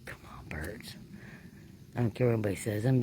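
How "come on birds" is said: hushed whisper, coaxing the birds. Real, not acted. Ghost hunter whispering to birds at night, hushed. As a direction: breathe it, willing them to call.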